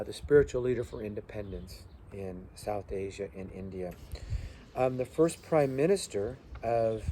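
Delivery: usual speaking voice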